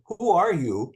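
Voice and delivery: ordinary delivery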